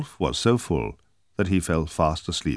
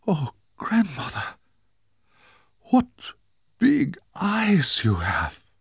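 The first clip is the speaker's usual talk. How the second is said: much higher, very slow, rising alarm; a girl suddenly frightened by the eyes; gasping girl; 13 semitones higher, 3 dB louder, slower than usual. Acted. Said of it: "she gasped".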